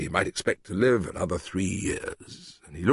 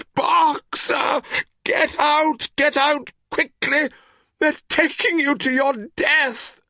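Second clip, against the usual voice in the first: high, loud anguished cry; terrible cry; 4 dB louder, slower than usual. A narrator's reading. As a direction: scream his name in anguish. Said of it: "cried Clover in a terrible voice".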